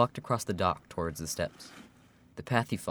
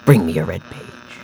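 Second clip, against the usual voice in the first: calm, calculated; 9 dB louder, slower than usual. Acted. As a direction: give orders with cold control. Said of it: "He spoke in a calm, calculated tone".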